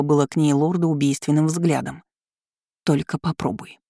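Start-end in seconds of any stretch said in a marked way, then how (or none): 2.8-3.8 understated hissed warning; hissed threat. A narrator's reading. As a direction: dare them with restrained menace. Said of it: "прошипела она".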